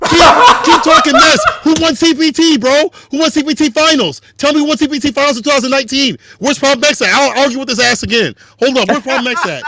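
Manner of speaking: high, rapid-fire mock indignation; heated trash talk. Real, not acted. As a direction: challenge them hotly, half in fun. Mock-heated comeback to rival's jab.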